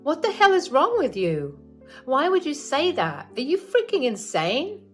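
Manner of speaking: outraged friend. Acted. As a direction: tear into the rude mother. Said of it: "She said".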